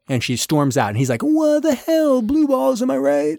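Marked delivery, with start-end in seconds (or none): 1.2-3.4 very high-pitched whining disbelief; hammed-up high voice of a man left hanging; mock outraged protest. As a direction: wail it high in comic frustration. Acted protest as she storms out.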